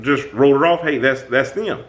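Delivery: ordinary talk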